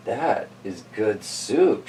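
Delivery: put-on quiet, slow, pleased old lady. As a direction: savour it like an old woman. Old lady tastes soup.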